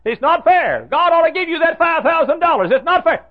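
rising outrage at the unfairness; mad complaint. Retold or imitated. Secretary protesting to the pastor, mock indignant and loud. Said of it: "She got mad at God".

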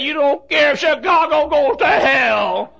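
high, slow shouted accusation; a preacher hammering the coach's words from the pulpit; coach's shouted plea. Retold or imitated.

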